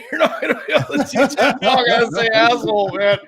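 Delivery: high and loud, laughing through the words; retold line, laughing loudly. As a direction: crack up while telling him off. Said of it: "and I told him".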